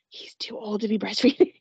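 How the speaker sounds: breathy whispered aside. Real, not acted. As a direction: whisper it like a secret. Whispered aside about the toddler.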